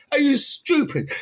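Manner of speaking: high, loud incredulous jab; scornful retort. Real, not acted. Vlogger scorning the video's threat, high and loud. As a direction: throw it back in scorn.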